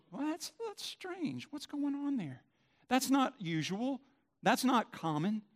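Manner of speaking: high, soft, drawn-out bemused voice; slow, high-pitched puzzlement at something strange; puzzled onlooker. Acted. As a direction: wonder aloud as a baffled outsider.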